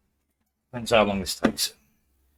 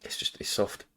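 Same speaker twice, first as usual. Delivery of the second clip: whispered, trying for her friend's soft voice; 9 dB quieter than usual. Shown as a demonstration. Streamer trying her friend's soft voice, whispered. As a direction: breathe it out in a whisper to show the softness. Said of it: "I can't do that soft".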